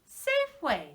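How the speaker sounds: high, loud store name; excited reveal. Shown as a demonstration. Teacher revealing the store to children, excited. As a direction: sing out the store name as the answer. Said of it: "do you know what that is?".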